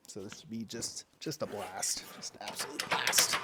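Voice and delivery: breathless, winded whisper; panting from lifting the log; heavy breathing. Real, not acted. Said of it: "I apologize for the heavy breathing".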